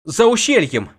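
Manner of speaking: high loud cry of alarm; alarmed shout. A narrator's reading. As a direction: shout the news in panic. Scout reports in alarm.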